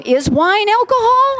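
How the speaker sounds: high, slow, baffled question; doubting herself, drawn-out high query; bewildered questioning. Retold or imitated. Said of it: "And then I'm questioning".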